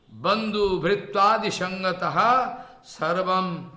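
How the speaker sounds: slow, measured chant with drawn-out syllables; Sanskrit verse recitation. Acted. Teacher reciting a verse of lament to listeners, slow chanted recitation. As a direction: intone each phrase slowly, pausing between.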